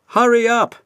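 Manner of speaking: high, loud nagging call; coaches. A narrator's reading. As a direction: chivvy the late engine. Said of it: "said the coaches".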